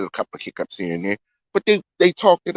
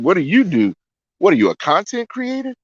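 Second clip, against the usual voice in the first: higher-pitched mock incredulous question; retold surprise; 7 semitones higher than usual. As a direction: quote him quizzing her in mild disbelief. Retold surprised question to his date.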